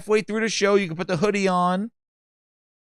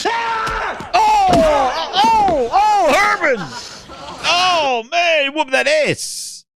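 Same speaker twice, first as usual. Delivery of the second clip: far higher, louder hyped yell; excited shout; 11 semitones higher, 3 dB louder, much slower than usual. Real, not acted. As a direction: yell it out, thrilled. Reacting hyped to the kick.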